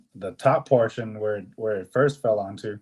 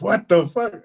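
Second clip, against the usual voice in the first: high, loud what the fuck; shocked outburst; 9 semitones higher, 6 dB louder, slower than usual. Retold or imitated. Dispatcher hearing there's a tree in the truck, loud shock. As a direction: dispatcher stunned.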